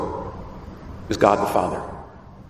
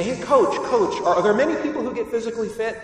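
higher, fast laughing question; eager trainee; 8 semitones higher, faster than usual. Retold or imitated. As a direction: rush the question at the coach. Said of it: "Somebody shows up to their coach and say".

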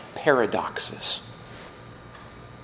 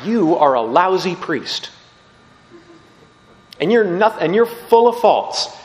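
louder, higher scolding voice; scolding lady; 5 semitones higher, 4 dB louder than usual. Retold or imitated. Woman confronting the priest after confession, loud and scolding. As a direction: tell him off with contempt.

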